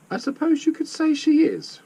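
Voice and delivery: high-pitched, very posh English. A narrator's reading. Lady answers the joking driver in a very posh voice. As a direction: put on very posh airs. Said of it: "in a very posh voice".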